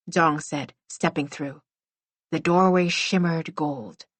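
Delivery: usual speaking voice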